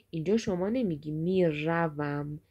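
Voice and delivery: ordinary talk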